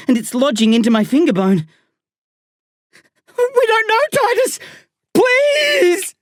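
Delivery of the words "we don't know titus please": very high, pleading scream. A narrator's reading. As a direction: scream in desperate appeal, far above your usual pitch. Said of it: "I scream".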